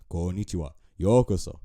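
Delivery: loud imitated Japanese greeting; playful, forceful Japanese-accented delivery; Japanese anime imitation. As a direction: punch out the Japanese like an anime character. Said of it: "listening to Japanese. They be like".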